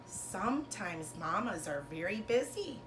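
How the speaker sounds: lilting picture-book read-aloud, bigger on each Llama Llama; reading to children. Acted. Teacher reading a rhyming bedtime book to her class. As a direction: read it like a bedtime story for little kids.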